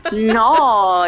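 loud Aussie "No."; Aussie accent. Shown as a demonstration. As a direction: belt out an Aussie "No.". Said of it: "Oh, that's good, [name]".

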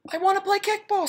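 put-on squeaky small kid pleading; high child voice asking to play; high kid voice for Ron.

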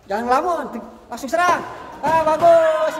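teriakan pelatih. Real, not acted. Coach calling out during sparring. (teriakan lantang pelatih)

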